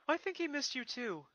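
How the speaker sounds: put-on high boy voice, matter of fact; young dragon. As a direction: tell her kindly. Spike about owl.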